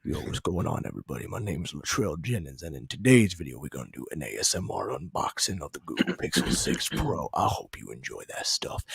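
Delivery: very low, hushed deep silhouette voice; Latrell Jennings. Retold or imitated. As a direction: murmur it deep and soft, imitating a late-night ASMR host. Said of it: "deep silhouette voice".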